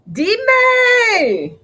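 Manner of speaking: loud, very high drawn-out holler; calling out. Pretend. Vlogger hollering a Spanish greeting to viewers, high and loud. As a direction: holler it high and hold the vowel.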